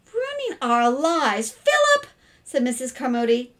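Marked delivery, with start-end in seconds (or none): 0.1-2.1 high, loud disbelief; drawn-out astonished question; incredulous. A narrator's reading. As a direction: echo it as an absurd charge. Mother repeats accusation in disbelief.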